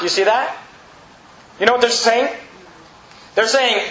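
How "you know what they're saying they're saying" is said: ordinary talk, nothing marked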